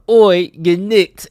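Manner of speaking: mock British accent. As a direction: bark it out like a London copper in a British accent. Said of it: "That's a good British accent".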